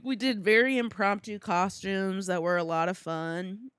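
ordinary talk

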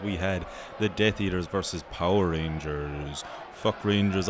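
normal talking voice

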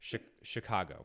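Chicago said as shi-KAH-go. Shown as a demonstration. Host showing friends how they say the city, Chicago with a plain ah. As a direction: say Chicago with an open ah in the middle. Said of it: "gonna say it one way".

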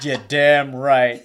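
loud, drawn-out proud confirmation; emphatic agreement. Real, not acted. Co-host owning up to the boyfriend tease, proudly emphatic. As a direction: own it slowly and with force.

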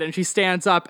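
usual speaking voice

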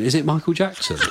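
asked through laughter, mock child; giggling as he imitates the kids; a mock kid's question, laughing. Kids asking about the look.

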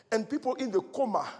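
ordinary delivery

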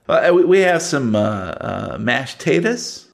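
Southern diner waitress listing the menu. Acted. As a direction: rattle off the specials in a Southern accent. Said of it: "Southern one minute and Cajun the next".

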